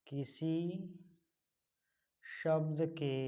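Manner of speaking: slow, softened word-by-word dictation; teacher dictating phrase by phrase, unhurried. Real, not acted. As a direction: leave long gaps for pupils to write.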